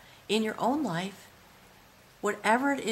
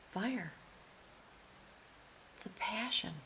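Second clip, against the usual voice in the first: hushed whisper; a reverent whisper over the card; awed whisper; 11 dB quieter than usual. Real, not acted. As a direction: breathe the word in wonder. Awed at the fire image.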